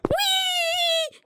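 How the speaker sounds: long high-pitched whee; playful squeal. Real, not acted. Gamer showing Dominic a move, playful high squeal. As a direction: squeal it out on one high note.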